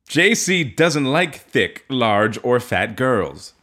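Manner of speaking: slow dramatic read. Acted. Dramatic reading of post.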